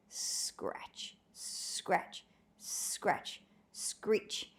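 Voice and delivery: whispered scratch sounds, very soft; hoe scratching noise. Acted. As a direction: rasp out the hoe noise under your breath. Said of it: "the noise of a hoe".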